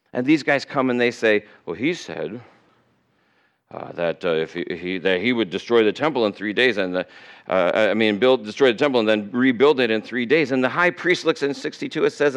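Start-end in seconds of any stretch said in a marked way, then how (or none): 1.6-10.5 low, halting mock witness; fumbling testimony. Witnesses testifying against Jesus, hesitant and fumbling. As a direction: stumble and correct yourself as a muddled witness.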